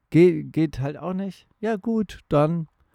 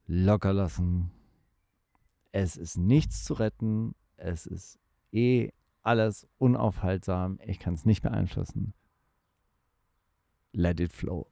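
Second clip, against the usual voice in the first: calm; 7 semitones lower, 6 dB quieter than usual. Retold or imitated. Calm letting-go voice.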